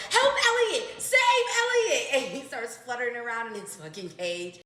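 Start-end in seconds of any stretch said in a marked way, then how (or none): 0.1-2.1 very high, loud squawking parrot cry; screeching parrot. Retold or imitated. Parrot screaming for help as grandma slaps the cage, very high and loud. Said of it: "Elliot goes".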